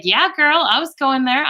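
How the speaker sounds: put-on high, gushing yeah girl; sassy girlfriend banter; playful high banter. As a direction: play up the joke with gusto.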